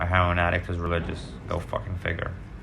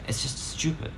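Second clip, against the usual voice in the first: a muttered unvoiced aside under the breath; whispered aside; 4 semitones higher, 6 dB quieter than usual. Real, not acted. Aside about the stupid plan.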